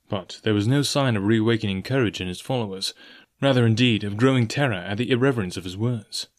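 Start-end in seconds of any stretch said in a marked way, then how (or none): none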